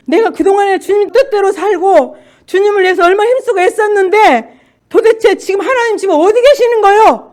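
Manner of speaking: loud, high, indignant outburst; ranting at God. Retold or imitated. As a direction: demand answers from God at full voice. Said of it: "난리를 치고 있더라고요".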